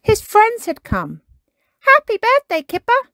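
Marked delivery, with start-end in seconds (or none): 1.8-3.1 much higher, louder cheery greeting; little friend bursting in gladly; Jake greeting. Acted. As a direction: Jake wishing Kipper happy birthday, cheerfully. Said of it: "said Jake".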